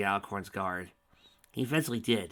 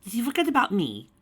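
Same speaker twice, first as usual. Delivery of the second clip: high, louder cackling taunt; cackling puppet mare; 13 semitones higher, 8 dB louder than usual. A narrator's reading. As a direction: cackle it gleefully. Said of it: "mare cackled".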